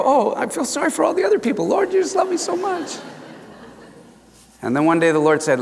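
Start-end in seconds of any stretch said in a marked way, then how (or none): 0.0-3.0 very high, put-on squeal; mock pity. Preacher mimics himself as God's favorite, high-pitched gushing. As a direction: gush in a silly high-pitched voice. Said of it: "I go".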